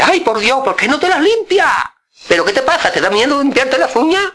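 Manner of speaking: mock scolding, pitch way up; exasperated uncle fussing over dirty nails, high voice. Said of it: "y dice".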